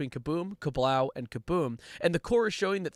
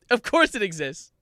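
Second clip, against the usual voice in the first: raised, louder exclamation; mild incredulity; 4 semitones higher, 5 dB louder than usual. Real, not acted. Comic finds the lyrics page exists, mild incredulity. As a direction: exclaim it with some disbelief. Mock disbelief, first take.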